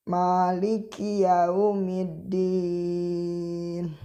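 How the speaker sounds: yaumiddin slow, the dd held a beat before the vowel; held doubled d. Shown as a demonstration. Teacher demonstrates the held tasydid to students, very slow. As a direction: press and hold the doubled dal in yaumiddin. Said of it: "Agak ditahan karena terdapat tasdid".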